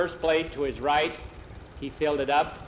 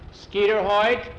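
sharp rise in volume and pitch; stern call-out; 6 semitones higher, 6 dB louder, slower than usual. Acted. Teacher catches a boy starting early, stern call-out.

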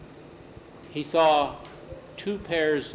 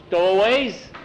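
loud, raised exclamation; mock surprise; 5 semitones higher, 7 dB louder than usual. A narrator's reading. Captain hears there are stowaways aboard, surprised exclamation. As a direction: repeat it loudly as if astonished.

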